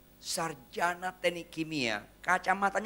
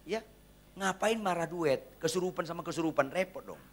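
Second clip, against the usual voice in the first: ordinary talk, nothing marked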